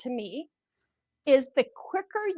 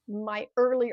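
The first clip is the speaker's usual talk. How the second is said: usual speaking voice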